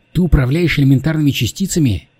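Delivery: firmly insistent questioning. Acted. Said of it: "настойчиво спросила девушка".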